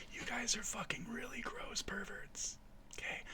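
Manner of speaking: hushed, disgusted whisper; whispered insult. Real, not acted. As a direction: whisper it at the audience like an accusation. Scolds viewers in a hushed aside.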